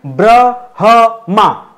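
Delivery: ब्रह्मा stretched very slowly, ह clearly sounded before म; slow, careful ब्रह्मा; drawn-out ब्रह्मा. Shown as a demonstration. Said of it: "ब्रह्मा होता है".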